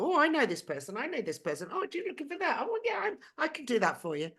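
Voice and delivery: bright, eager voices popping up; light, cheery mimic of helpful strangers; eager helpful people. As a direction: play the friendly helpers who turn up. Voicing helpful people who appear.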